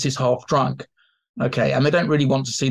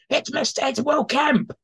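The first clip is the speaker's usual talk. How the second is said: excited audience recognition; 12 semitones higher than usual. Retold or imitated. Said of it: "the audience would see him as".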